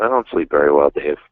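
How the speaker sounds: normal talking voice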